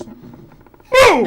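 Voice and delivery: sudden loud, high "boom" for a punch; a burst of mock impact noise. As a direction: shout the impact out explosively. Acting out a punch landing.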